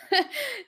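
high, short giggle; a quick high-pitched laugh; light laugh. Real, not acted. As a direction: giggle briefly to yourself. Laughs after deciding against names.

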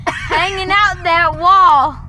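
put-on Southern accent. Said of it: "Southern accent".

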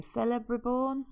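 "Celebriborn?" tried slowly, each syllable sounded out, rising pitch like a guess; unsure pronunciation. Shown as a demonstration. Co-host unsure how to say an elf's name, tentative. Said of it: "how do you say his name?".

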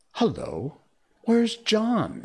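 put-on high-pitched boy voice; lightly acted boy, pitch leaping up; boy's sudden question. A narrator's reading. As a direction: ask it high, suddenly puzzled. Narrator voices boy Peter asking.

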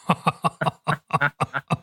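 loud burst of laughter; laughing. Real, not acted. Friends swapping jokes, laughing loudly at the punchline.

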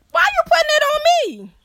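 very high, fast, squeaky whine; mock squeak. Mocking the jealous girls, high squeaky whine.

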